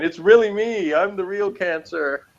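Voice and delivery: high, hammed-up announcement of a villain's sign; mock melodrama voice. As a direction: ham it up like a stage melodrama villain.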